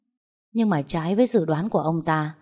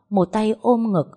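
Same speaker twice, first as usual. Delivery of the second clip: usual speaking voice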